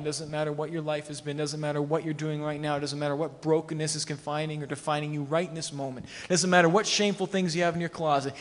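ordinary delivery

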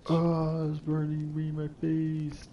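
high, drawn-out playful wail; high-pitched mock cry of pain; mock agony. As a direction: pretend the fire is burning you. Mock complaint about glitched torch fire.

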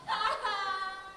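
loud gleeful cackle; mischievous laugh. Acted. Sid laughing at Spike covered in berries. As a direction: laugh at the trick you pulled. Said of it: "Laughed Sid".

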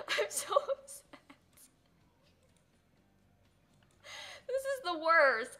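high, slow, mournful moan; sad, tearful. Real, not acted. Streamer mourning Tiny Rat Dog, slow tearful moan. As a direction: drag it out in grief.

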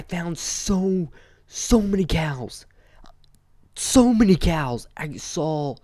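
slow, high, marvelling so many cows; awed about cows. Real, not acted. Gamer telling viewers about cows, slow and awed. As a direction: stretch it out in awe.

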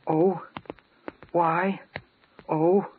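usual speaking voice

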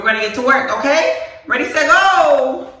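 loud, high call to start; loud, high hype call; hyped countdown. Real, not acted. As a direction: pump yourself up and shout go.

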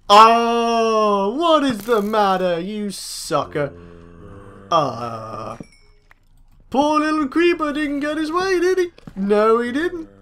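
drawn-out, babying mock sympathy; mock pity taunt. Player taunting a stuck creeper, mock pity. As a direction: tease it like a sulking baby, pitch climbing.

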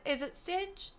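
ordinary delivery

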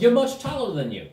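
high, animated gnome voice; insistent gnome. Acted. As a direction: repeat it with a swooping emphasis. Gnome repeats it emphatically.

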